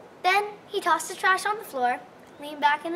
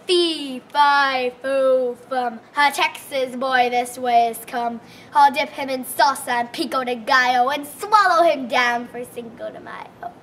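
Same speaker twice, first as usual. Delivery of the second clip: giant's voice; 3 semitones lower, 7 dB louder than usual. A narrator's reading. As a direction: boom it out like a hungry giant. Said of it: "the giant came in and sniffed the air".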